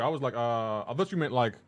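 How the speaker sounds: normal talking voice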